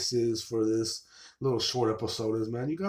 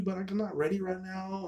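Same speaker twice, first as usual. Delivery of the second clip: higher-pitched imitated excuse; mock brush-off; 8 semitones higher, 4 dB quieter than usual. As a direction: pitch it up, imitating a reluctant woman. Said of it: "Instead of like".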